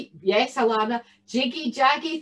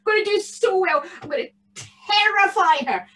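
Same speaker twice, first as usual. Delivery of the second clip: high, fast, gleeful goblin chatter; a bogle gloating over his plan; excited bogle; 7 semitones higher, 3 dB louder, faster than usual. Acted. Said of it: "in excitement".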